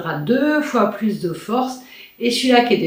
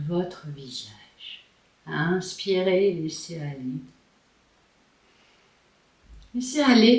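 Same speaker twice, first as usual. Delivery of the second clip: sultry narrator. Acted. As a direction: guide them gently into calm. Leads a face yoga relaxation.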